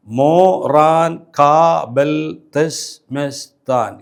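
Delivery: "Moran Kabel തെശ്മെസ്താൻ" split into seven slow beats; very slow, separated syllables in "തെശ്മെസ്താൻ". Shown as a demonstration. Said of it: "ഏഴ് syllable".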